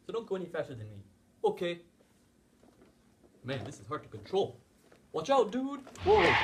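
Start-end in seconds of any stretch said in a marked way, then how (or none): 5.1-6.3 high, loud panicked yell; alarmed shout. Acted. Car out of control.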